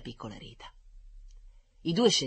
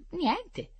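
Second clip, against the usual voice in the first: put-on little girl. Girl answers doctor.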